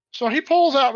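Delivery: ordinary talk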